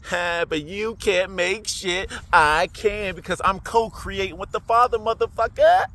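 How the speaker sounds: gloating taunt, stretched out then climbing; cussing out. Retold or imitated. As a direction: crow defiantly at the devil, building to a high loud last word. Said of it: "I started to cuss out the devil".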